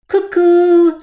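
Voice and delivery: high, loud cuckoo call across the garden. A narrator's reading. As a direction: call out with conviction. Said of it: "this time louder and with greater conviction".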